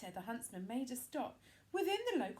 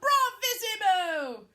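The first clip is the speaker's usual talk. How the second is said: queen crying out; 8 dB louder, slower than usual. A narrator's reading. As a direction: relish the news with cruel delight. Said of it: "The Queen cried out".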